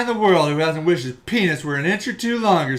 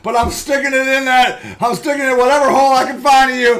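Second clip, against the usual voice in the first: higher, louder comic hero cry; hammed-up rescuer; 7 semitones higher, 4 dB louder than usual. Guy in the picture answering her, hammed-up heroic call. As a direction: play the bumbling hero charging in. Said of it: "She's like".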